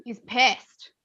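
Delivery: ordinary talk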